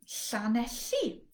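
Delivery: Llanelli slow, both ll sounds hissed; slow Welsh ll. Shown as a demonstration. Teacher showing ll at start and middle. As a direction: stretch out Llanelli and hiss each ll. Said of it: "at the beginning and in the middle".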